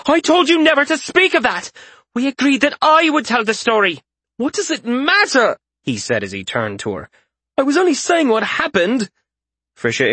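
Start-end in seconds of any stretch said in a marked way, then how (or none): 0.1-4.0 high, loud furious rebuke; angry scolding. A narrator's reading. Isaria scolds Malseus for telling the story, high and loud. 4.4-5.6 defensive retort. A narrator's reading. Malseus retorts to Isaria's scolding, high and indignant. 7.6-9.1 high, louder indignant protest; defensive protest. A narrator's reading. Malseus protests to Isaria, high and indignant.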